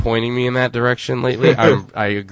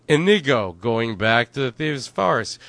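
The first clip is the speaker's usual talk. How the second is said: ordinary delivery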